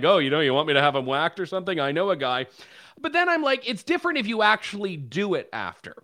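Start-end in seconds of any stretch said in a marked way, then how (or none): 0.0-2.5 quick, offhand mock-mobster aside; a hint of a wiseguy offering a favour, fast; joking spy boss. Imagined CIA director joking with friends, fast offhand wiseguy tone. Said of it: "but I'd be like".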